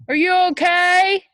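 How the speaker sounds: high, loud call up the ladder. A narrator's reading. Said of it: "called his dad".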